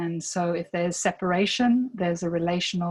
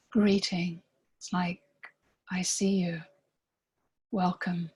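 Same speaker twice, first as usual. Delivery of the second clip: guided meditation. Real, not acted. Guide inviting listeners to breathe into themselves, calm meditation voice. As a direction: lead them gently inward, leaving space between phrases.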